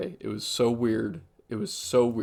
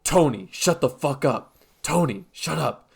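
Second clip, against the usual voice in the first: imitating her snapping at an invisible Tony, louder; a hammed-up, louder telling-off of someone who isn't there; mock angry snap; 4 semitones higher, 6 dB louder than usual. Retelling the customer yelling at nobody, mock angry and louder. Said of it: "all of a sudden she's like".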